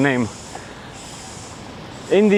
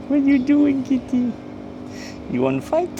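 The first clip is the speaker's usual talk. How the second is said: high squeaky teasing of a cat; playful cat talk; 13 semitones higher than usual. Real, not acted.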